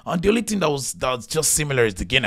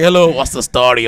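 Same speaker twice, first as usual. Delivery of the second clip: put-on Irish accent; 3 semitones higher, 12 dB louder than usual. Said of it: "I couldn't even understand the accent".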